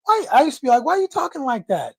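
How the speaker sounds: ordinary delivery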